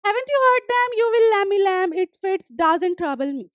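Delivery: reading the story text slowly, word by word; slow reading aloud. Acted. Teacher reading the story aloud to students, slow and clear. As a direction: read each English line slowly and clearly for the class.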